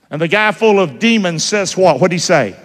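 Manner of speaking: ordinary delivery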